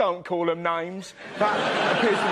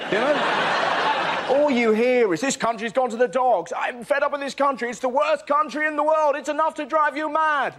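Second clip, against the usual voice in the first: louder, higher grumpy moaning; mock rant; 5 semitones higher, 3 dB louder than usual. Comic mimicking grumbling Brits, mock rant. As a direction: rant like an old complainer.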